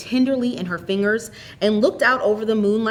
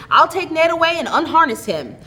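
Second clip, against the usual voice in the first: high and louder, quick and practical; brisk; 8 semitones higher, 4 dB louder than usual. A narrator's reading. Theodora sending her tired aunt in from the cold, brisk. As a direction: hurry her inside, all business. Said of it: "she said briskly".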